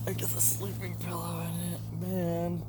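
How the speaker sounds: hushed bedtime whisper. Real, not acted. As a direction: whisper it in the tent. Lying in tent at night.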